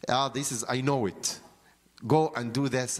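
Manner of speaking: ordinary talk, nothing marked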